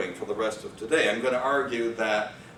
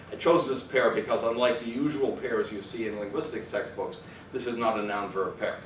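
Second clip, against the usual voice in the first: ordinary talk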